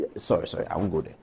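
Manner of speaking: very low, soft retreat; hushed self-correction. Real, not acted. Speaker stopping short of a personal remark, hushed apology. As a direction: back off under your breath. Checks himself mid-sentence, apologising.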